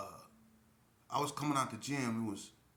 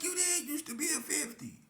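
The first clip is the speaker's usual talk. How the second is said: naive protest. Retold or imitated. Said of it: "I'm like".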